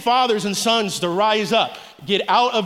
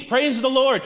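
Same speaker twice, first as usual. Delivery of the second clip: ordinary talk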